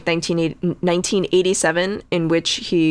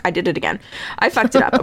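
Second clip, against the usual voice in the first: normal talking voice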